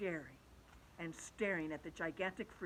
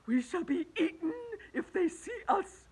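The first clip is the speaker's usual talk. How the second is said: panicked scolding worm; 11 semitones higher, 8 dB louder than usual. Acted. Earthworm hushing the centipede, shrill frantic scold. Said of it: "the earthworm whispered".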